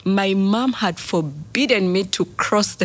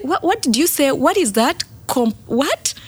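confused mother; 7 semitones higher than usual. Retold or imitated. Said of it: "she's like".

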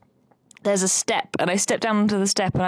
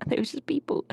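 whisper; 7 semitones higher, 6 dB quieter, faster than usual. Real, not acted. Whispered aside about unknown wrestlers.

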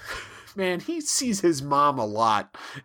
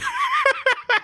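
loud high laugh; 4 dB louder than usual. Real, not acted. Cohost cracking up at Harry's story, loud high laugh. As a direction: burst of high-pitched laughter.

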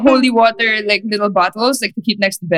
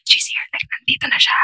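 whisper; 3 dB quieter than usual. Retold or imitated. Whispering ghosts in closet.

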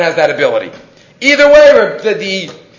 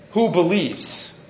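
ordinary talk, nothing marked

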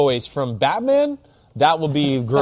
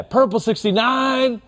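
"purple sixty-nine" called higher, with room to breathe; spaced-out snap count; 7 semitones higher, slower than usual. Shown as a demonstration. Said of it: "Let it breathe a little bit".

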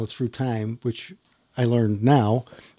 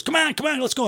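high, pushy mock officer voice; officer urging; 13 semitones higher than usual. Officer pushes the rookie down the hallway, high and pushy. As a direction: chivvy him along in a high imitation.

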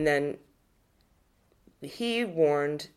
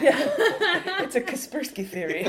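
laughing; 11 semitones higher than usual. Real, not acted. Joking about conspiracy theories.

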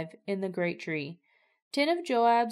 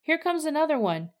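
higher, lightly raised call from the roof; shouting down; 7 semitones higher, 3 dB louder than usual. A narrator's reading. Watchman calling down to David from the gateway roof, mild shout. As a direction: call it down to the king. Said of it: "He shouted down".

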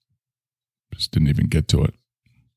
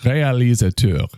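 higher-pitched put-on French accent; French accent attempt; 4 semitones higher than usual. Shown as a demonstration. Host showing off a French word, put-on French accent. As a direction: say it as a mock Frenchman would. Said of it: "see if you can do a French accent".

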